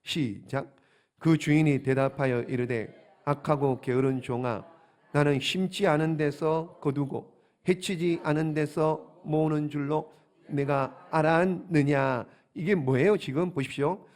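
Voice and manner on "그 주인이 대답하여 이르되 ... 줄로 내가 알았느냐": low, slow, measured scripture reading. Acted. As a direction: read the verse out solemnly in deliberate phrases. Reads the scripture aloud. (낮고 느린 성경 봉독)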